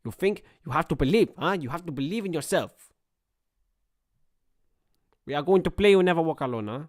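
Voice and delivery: imitated manager with a put-on foreign accent; earnest mock team talk in an accent; Mikel Arteta. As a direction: urge the players on like an accented coach. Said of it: "Mikel Arteta's doing a team talk".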